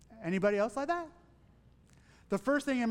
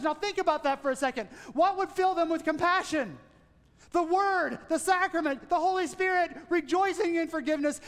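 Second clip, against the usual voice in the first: fervent pulpit preaching, raised and driving; rising preaching; 6 semitones higher than usual. Acted. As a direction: preach it with building conviction. Preaching rises to full force.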